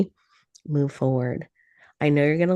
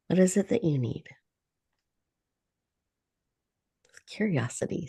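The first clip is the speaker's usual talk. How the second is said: guided meditation. Acted. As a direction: ease them into calm breath by breath. Leads a guided breathing meditation.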